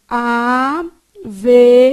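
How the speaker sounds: "averigue" drawn out slowly, a-ve-ri-GU-e, with U and E separate; syllable by syllable. Shown as a demonstration. Teacher showing students how the word is written, syllable by syllable. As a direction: sound out "averigue" slowly, each syllable apart, stressed U. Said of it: "Você tem que respeitar o que tá escrito".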